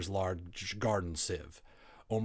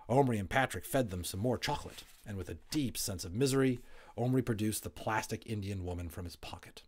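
usual speaking voice

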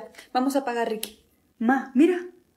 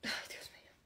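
exasperated whisper; 23 dB quieter than usual. Acted. Embarrassed reaction to flirting.

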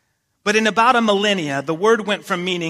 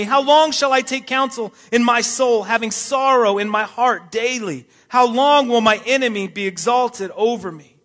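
preaching at full force; 3 semitones higher, 5 dB louder than usual. Acted. Pastor reads Psalm 13 aloud to the church, loud fervent preaching. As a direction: cry out the psalm to the congregation.

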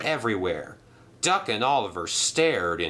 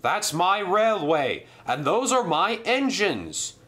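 indignant controller; 9 semitones higher than usual. Acted. Sir Topham Hatt scolds Sailor John, outraged. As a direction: defend his railway in dismay.

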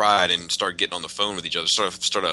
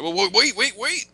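high whiny wait, wait; mock panicked company; 12 semitones higher, 4 dB louder than usual. Fan mocks Masterpiece begging others to wait, high whine. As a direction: squeal a flustered protest.

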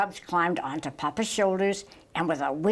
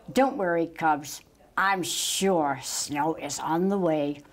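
usual speaking voice

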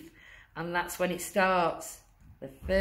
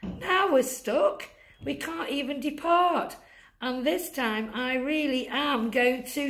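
1 desperate Santa; 6 semitones higher than usual. Acted. Santa voiced in story.

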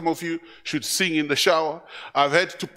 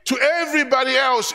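usual speaking voice

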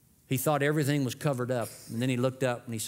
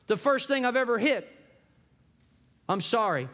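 put-on higher, anxious little boy voice; boy's confession; 9 semitones higher than usual. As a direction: blurt it out like a guilty child.

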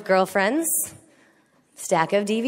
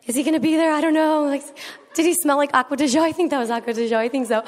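giddy obsessing; 6 semitones higher, faster than usual. Retold or imitated. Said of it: "You're like".

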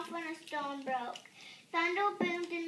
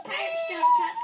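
much higher, much louder outraged roar; bellowing pirate; 10 dB louder than usual. A narrator's reading. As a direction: roar it as the offended captain. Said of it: "he bellowed".

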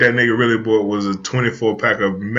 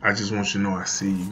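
mock come-on whisper; 6 semitones lower, 11 dB quieter than usual. Creepy friend murmuring to a married man, mock whispery. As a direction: murmur it low and unhurried, as if imitating.

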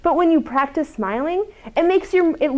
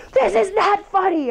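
higher, clipped mock anger; mock angry retort; 9 semitones higher, 3 dB louder than usual. Seething coworker to the one smiling, mock anger. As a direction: act out the fuming coworker, lightly. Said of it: "the person who's seething mad is like".

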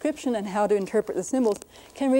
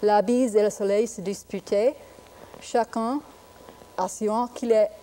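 French pronunciation of the fable; reading in French. Shown as a demonstration. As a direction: read the fable aloud in French. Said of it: "In French".

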